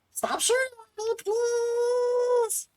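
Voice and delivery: high, fast gremlin squeak. Acted. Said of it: "still a gremlin voice".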